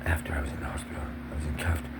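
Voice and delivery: whisper. Real, not acted. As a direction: drop to a secret whisper. Confiding the cuffing in whisper.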